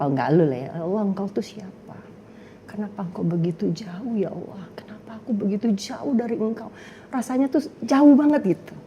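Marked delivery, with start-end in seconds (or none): 0.8-6.7 soft, pleading prayer to God; quiet searching prayer; hushed prayer. Retold or imitated. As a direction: murmur it like a lonely plea. Retold anguished prayer, hushed.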